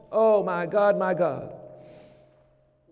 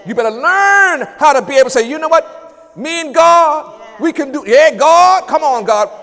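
full-force preaching; 6 semitones higher, 7 dB louder than usual. Real, not acted. Preacher shouting down the negative voice, loud. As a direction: shout it down with praise.

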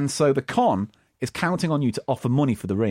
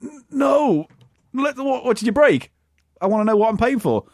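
victim; 7 semitones higher than usual. Retold or imitated. Acted victim quote.

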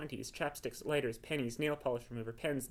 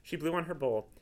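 plain reading voice

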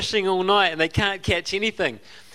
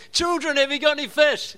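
high, carrying call across the water; projected call; 10 semitones higher than usual. Shown as a demonstration. Jesus calling from the shore to the fishermen in the boat, a high projected call. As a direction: throw your voice a hundred metres to the boat. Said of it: "projected his voice".

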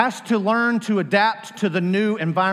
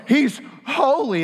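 slow, high, full of wonder; awed; 9 semitones higher, 4 dB louder, much slower than usual. Retold or imitated. Believer in heaven, slow and awestruck. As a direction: let it fall in awe. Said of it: "gonna look and go".

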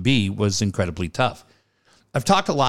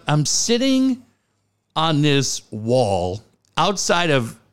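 normal talking voice

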